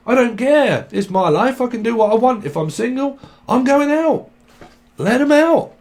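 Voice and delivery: high-pitched, insistent mock protest; acted defiance. Single person insisting on going out, defiant. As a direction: throw off the nagging, pitch climbing.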